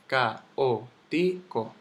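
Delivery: caótico very slowly as ca-ó-ti-co; syllable by syllable. Shown as a demonstration. Teacher showing the hiatus, very slow separate syllables. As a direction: pull caótico apart, one syllable at a time. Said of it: "es un hiato".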